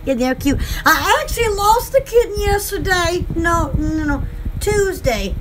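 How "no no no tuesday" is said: ordinary talk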